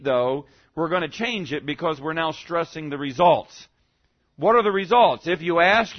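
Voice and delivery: usual speaking voice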